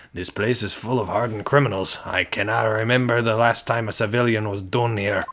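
put-on amateur Scottish accent for a prison governor; attempted Scottish accent. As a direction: do a shaky-at-best Scottish accent. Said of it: "I can't do a Scottish accent".

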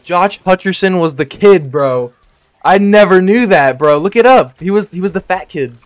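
high, loud and incredulous; amazed excitement. Real, not acted. Streamer tells chat about the casting discovery, amazed and excited.

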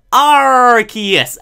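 long, loud, drawn-out pirate "arrr" opening Arceus. Acted. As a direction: stretch the start of Arceus into a pirate roar. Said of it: "'Cause it's R and that's what a pirate says".

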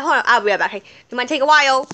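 ordinary delivery

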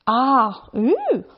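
ah, then a high surprised ooh; a child's wondering discovery; curious gasp. A narrator's reading. Child spotting marks in the snow, curious ooh.

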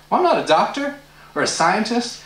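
ordinary talk

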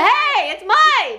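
high indignant yell; shouting. Real, not acted. Fighting over chocolate.

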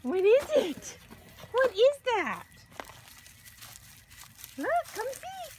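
loud, high excited asking; rising thrill at a mystery. Real, not acted. Owner hyping up the dogs about the package, loud and high.

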